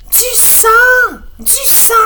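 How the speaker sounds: very high, loud shriek; screaming mother. Acted. Mother seeing the bleeding finger, high screaming. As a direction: scream it in panic. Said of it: "crie la maman de Laurie".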